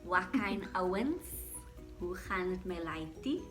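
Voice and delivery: slow Joburg slang with Afrikaans; Joburg accent. Shown as a demonstration. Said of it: "I'll do Joburg".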